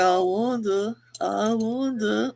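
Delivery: slow drawn-out "I wonder, I wonder" with a rising end; imitating Christy. Mimicking Christy's dreamy musing, slow and drawn out. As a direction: stretch the wonder out slowly, dreamy. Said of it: "Chris to talk like this".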